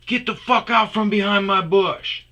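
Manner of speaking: furious shout. Retold or imitated. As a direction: chase him off with a bellow. Shouting at man in bush.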